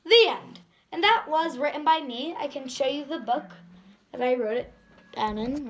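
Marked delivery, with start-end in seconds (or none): 0.0-0.6 bold spoken-word delivery, a touch louder; slam poetry recitation. Acted. Girl performing her slam poem for viewers, earnest.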